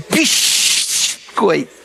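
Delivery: "Biscoito" slow, with Carioca sh before t; Carioca accent. Retold or imitated. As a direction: mimic a Carioca saying "Biscoito". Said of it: "fala com um carioca". (sotaque carioca em "Biscoito")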